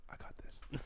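hushed, confiding whisper; barely audible, secretive; whispered aside. Acted. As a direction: whisper it to a friend in the cinema. Whispered aside to friend in cinema.

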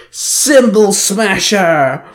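long, high, drawn-out heroic call; weapon call-out. A narrator's reading. Ranger summons her hammer, calling out. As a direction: stretch it out like an attack name. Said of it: "she called out".

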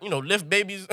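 ordinary talk, nothing marked

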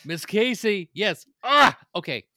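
high, loud outburst with a laugh; excited freak-out. Real, not acted. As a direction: freak out loudly at the name. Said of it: "for us to have to freak out".

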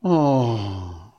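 long, falling moan. A narrator's reading. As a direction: let it slide down in a moan. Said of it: "they all moaned".

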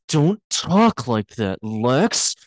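oddly drawn-out imitated plea; weird delivery. Lana answering Lex's talk of emergency, weirdly drawn out. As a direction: imitate her weird line, stretching it slowly. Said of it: "Why did she say it like that?".

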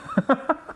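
short high laugh at her own slip; amused laugh. Real, not acted. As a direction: laugh it off after the misread.

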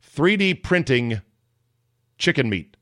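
ordinary talk, nothing marked

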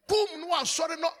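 high, loud protest from a nightmare; dream cry. Retold or imitated. Retelling a nightmare to the church, high loud cry. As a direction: cry out no in panic, mock-retold. Retold dream fight, crying out.

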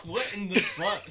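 high, loud and cracking up; laughing disbelief. Retold or imitated. Recruit hearing the instructor's joke, incredulous through laughter. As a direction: retell astonishment while bursting into laughter.

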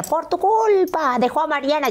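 high, drawn-out wail of blame; mock accusation. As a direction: exaggerate the public's reproach, slow and high. Mocking what many people said. (reproche exagerado, agudo y alargado)

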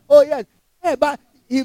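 ordinary talk, nothing marked

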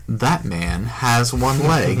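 slow, drawn-out statement of fact; matter-of-fact. Retold or imitated. Turning to Jesse to announce it, matter-of-fact. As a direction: state it slowly, as plain fact. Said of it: "it was just like a fact".

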